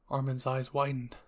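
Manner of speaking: ordinary delivery, nothing marked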